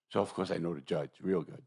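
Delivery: normal talking voice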